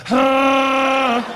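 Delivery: long, held creature howl; Chewbacca. Acted. Son caught by his mother, mock howling. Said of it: "I was like Chewbacca".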